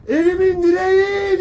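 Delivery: crying out, voice breaking as the grieving mother; wailing mother. Retold or imitated. Commander voicing the soldier's mother crying out for her son, wailing. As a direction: wail it like a mother who lost her son. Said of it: "diye ağlıyor".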